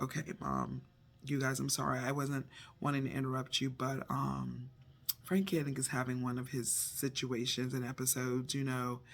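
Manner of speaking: low, soft, unhurried son's report; calm and quiet. Retold or imitated. Son calmly tells mom his brother has an episode, quiet and low. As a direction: speak softly and calmly, like nothing's wrong. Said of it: "And he's so calm".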